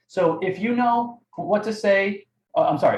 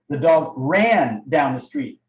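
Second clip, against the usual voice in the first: "ran" stretched and punched, slow pace; stress on RAN; 3 semitones lower, slower than usual. Shown as a demonstration. Coach demonstrating tonality to trainees, stress on "ran". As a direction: draw out and lean on "ran". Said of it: "emphasis on running down the street".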